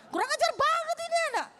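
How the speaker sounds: high, fast indignant burst; furious outburst. Retold or imitated. Teacher reacts to cheeky pupil, furious outburst. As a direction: act out a teacher losing her temper. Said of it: "Oh, emosi".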